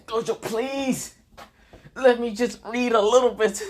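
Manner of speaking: desperate begging. Acted. Kakashi begs Gojo to let him read, desperately pleading. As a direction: plead pitifully, voice way up high.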